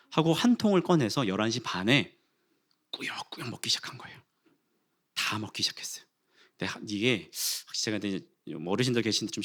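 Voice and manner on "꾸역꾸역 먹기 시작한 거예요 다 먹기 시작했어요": hushed, sheepish confession; confessional whisper. Real, not acted. Pastor confessing to the congregation that he ate it all, whispered. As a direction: whisper it like a guilty secret.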